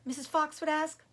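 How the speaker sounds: usual speaking voice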